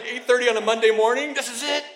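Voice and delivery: loud, high, giddy exclamation; hyped-up onlooker's glee; thrilled disbelief. Retold or imitated. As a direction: revel in the drama, pitch way up. Retold glee at watching a quarrel.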